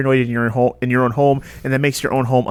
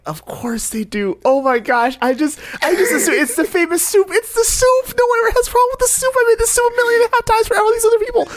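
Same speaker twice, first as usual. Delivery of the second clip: rising higher and faster into a squeaky panic; flustered girlfriend. Retold or imitated. Cohost voicing the girlfriend panicking over the soup, frantic squeak. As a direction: ramp up into a frantic high babble. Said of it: "I probably registered of, like".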